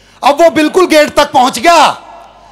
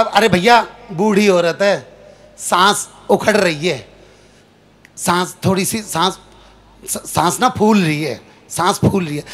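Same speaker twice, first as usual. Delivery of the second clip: low, soft, slowing murmur; soft hushed aside, trailing off; hushed bystander; 7 semitones lower, 6 dB quieter than usual. Retold or imitated. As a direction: explain it quietly and fade away. Bystander on breathless granny.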